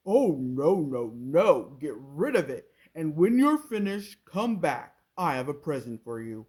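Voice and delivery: loud crocodile waving it off; booming, grateful no. A narrator's reading. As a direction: crocodile refusing his old tooth. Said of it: "crocodile answers the mouse".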